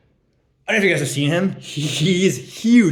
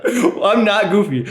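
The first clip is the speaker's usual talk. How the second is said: indignant friend; 7 semitones higher, 5 dB louder, slower than usual. Retold or imitated. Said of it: "And he was like".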